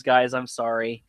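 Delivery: ordinary talk, nothing marked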